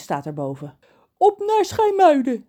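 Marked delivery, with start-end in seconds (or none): 1.2-2.5 high, loud cheerful shout. Acted. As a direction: set off with gusto. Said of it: "zegt Reus vrolijk".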